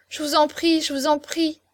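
acted young woman imploring; pleading. A narrator's reading. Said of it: "murmura-t-elle".

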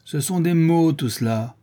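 high start, dropping at the end; shouted dismissal. A narrator's reading. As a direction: cry it out scornfully as the ringleader. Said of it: "cria le charron". (objection criée)

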